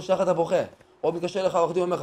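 normal talking voice

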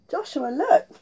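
usual speaking voice

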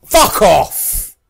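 loud, high burst of rage; explosive, exasperated dismissal; furious shout. Real, not acted. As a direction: yell it at the game. Killed again, match ending.

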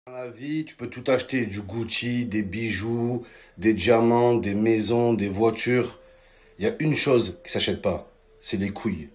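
low, soft gravitas; solemn maxim. Acted. Comedian closing with a life lesson, low and soft. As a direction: deliver it like a lesson, quietly.

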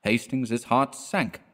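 ordinary delivery, nothing marked

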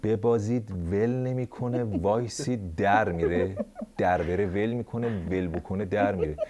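low, soft parody of the official; mocking echo. Host parroting the official in a low mocking voice. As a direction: repeat his words in a deep, mumbling mock. Mocking S1's words back.

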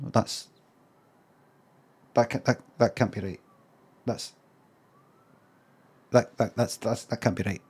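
quiet, low mock disbelief under the breath; imitating a TV host's hushed disbelief; muttered disbelief. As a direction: mutter it as if the price can't be right. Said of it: "he goes like this".

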